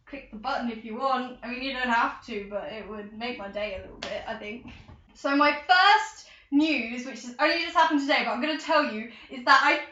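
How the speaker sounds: ordinary talk, nothing marked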